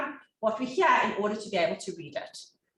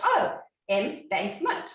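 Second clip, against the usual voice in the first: slow careful reading; much slower than usual. Retold or imitated. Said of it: "So she goes".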